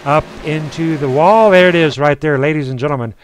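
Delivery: animated crash commentary, rising at the end; live motorsport announcer; race play-by-play. Real, not acted. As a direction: call the wreck as it unfolds.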